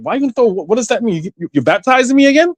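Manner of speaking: mock confused teenager pushing back; rising disbelief, quick; baffled retort.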